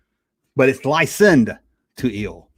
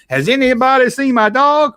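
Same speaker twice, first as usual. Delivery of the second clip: Muppet asking; 9 semitones higher, 5 dB louder than usual. Retold or imitated. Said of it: "He would go up and say".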